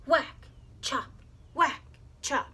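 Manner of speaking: punchy sound-effect blows; axe whacks and chops. A narrator's reading. Narrator mimicking the tired man's axe, punchy blows. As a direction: beat out each blow.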